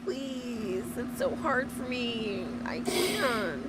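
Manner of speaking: high pleading mock voice; hammed-up pleading streamer; mock begging. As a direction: beg in a whiny put-on voice. Mocking streamer begging for gifts.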